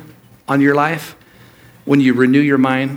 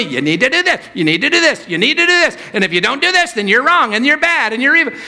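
mocking, insistent nagging, rising higher each time; pushy advice-givers; 8 semitones higher, faster than usual. Pastor mimicking church people nagging him, rising insistence. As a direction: mimic people pressing demands on you. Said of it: "are telling me".

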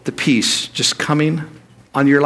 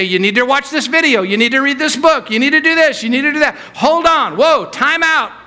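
mocking nag, higher and higher, then a startled time out; pushy advice-givers; 8 semitones higher than usual. Pastor imitating people flooding him with advice, mock insistence. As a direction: pile the demands on, then throw up the brakes. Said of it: "And they say".

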